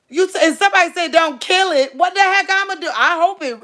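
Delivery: high, loud, fast and panicked. Real, not acted. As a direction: blurt it out in a fright. Panicked about killing mouse.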